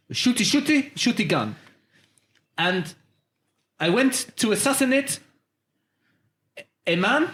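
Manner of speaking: put-on halting French accent with phrases broken by pauses; complaining French customer. Customer complaining about a fake gun, halting French accent. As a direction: play an aggrieved French caller, word by word.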